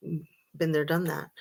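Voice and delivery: sarcastic. Real, not acted. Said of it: "sorry for the sarcasm".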